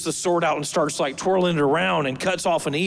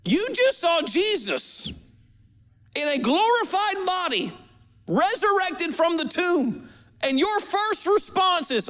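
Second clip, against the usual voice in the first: incredulous; 10 semitones higher than usual. Acted. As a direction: call Peter out in high-voiced amazement. Mock disbelief at Peter's response.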